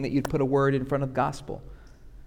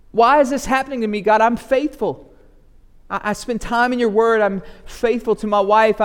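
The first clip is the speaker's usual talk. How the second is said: loud, high, pleading protest to God; indignant prayer; 5 semitones higher, 4 dB louder than usual. Retold or imitated. Preacher acting out his indignant prayer in a raised voice. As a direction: demand answers from God, wounded and righteous. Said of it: "looking all kinds of indignant before God and say".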